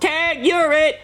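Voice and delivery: put-on high, gleeful kid's shout; kid yelling tag. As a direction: yell it like a cheeky kid tagging someone. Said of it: "And he yells".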